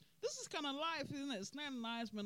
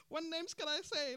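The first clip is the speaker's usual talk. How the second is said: asked through laughter; laughing; 8 semitones higher, 3 dB louder than usual. Real, not acted. Vlogger laughing at party nicknames, laughing question. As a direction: giggle out the question.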